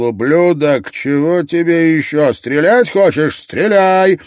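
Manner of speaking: loud, high, defiant shouting; angry yell. A narrator's reading. Ott dares Gref to fire the rifle, angry yell. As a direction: yell a furious dare. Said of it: "заорал".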